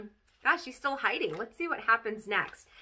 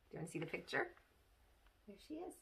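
ordinary delivery

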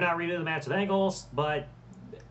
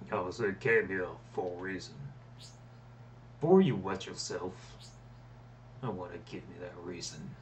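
much lower, dark and looming; menacing Undertaker voice; 8 semitones lower, 7 dB quieter than usual. Pretend. Gamer voicing Undertaker's taunt on screen, low and menacing. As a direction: read Taker's lines like a grim deadman.

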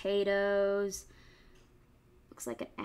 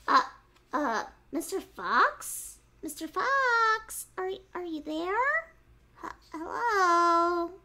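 nervous piglet; 8 semitones higher, 4 dB louder, slower than usual. Acted. Piglet finds fox gone.

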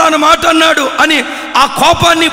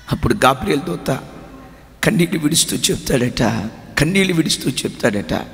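hushed sermon storytelling; 12 semitones lower, 6 dB quieter than usual. Acted. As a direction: draw the congregation in quietly. (నెమ్మదిగా, మెల్లగా చెప్పే ప్రసంగ శైలి)

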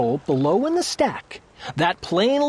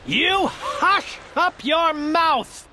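mock howl; 5 semitones higher, slower than usual. A narrator's reading. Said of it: "howled the mighty King Yertle".

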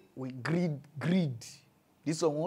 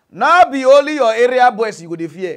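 loud warning; 5 semitones higher, 13 dB louder than usual. Real, not acted. Heated warning to the girls.

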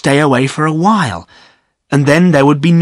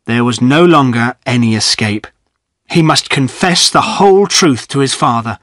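usual speaking voice

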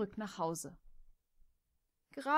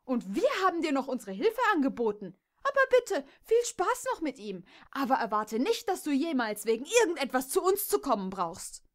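loud, higher, fast bitter outburst; spiteful anger; 5 semitones higher, 6 dB louder than usual. Acted. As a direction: snap at her with wounded spite. Kimberly storms off insulting Jess. (Kimberly beschimpft Jess verbittert, laut und hoch)

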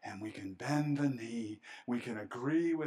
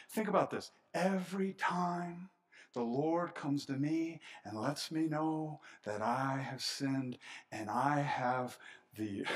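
usual speaking voice

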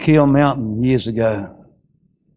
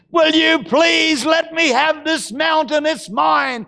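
shouted demand; slower than usual. Acted. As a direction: demand the mountain outright. Caleb's plea, repeated.

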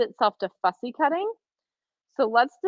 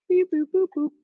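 playful robot beeps; 9 semitones higher than usual. Pretend. Moving the camera view playfully.

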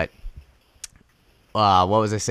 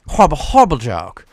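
mock disgust; 3 semitones higher, 5 dB louder than usual. Real, not acted. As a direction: repeat it with hammed-up disgust.

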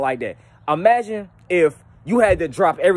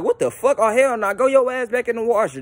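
high-pitched, mock outrage; indignant disbelief; 7 semitones higher than usual. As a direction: send it straight back, appalled. Acted reaction to clothes coming back dirty.

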